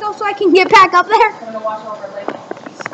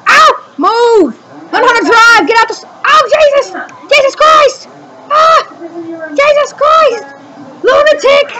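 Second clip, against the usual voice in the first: angry yelling; 6 semitones higher than usual. Real, not acted. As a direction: shout them off the track in a rage. Raging at other drivers in race.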